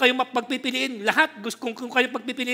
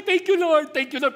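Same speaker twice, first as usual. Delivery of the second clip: high, mock tearful thank you; 6 semitones higher, 4 dB louder than usual. Preacher acting a worshipper, high and mock tearful. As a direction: imitate a believer thanking God while crying. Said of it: "pero umiiyak".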